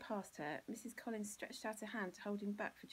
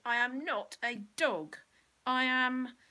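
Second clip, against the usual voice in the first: louder and higher, full fury; savage; 5 semitones higher, 12 dB louder than usual. Acted. Bill shouts down Mean Malcolm, savage and loud. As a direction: make every word a blow. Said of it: "continued Bill savagely".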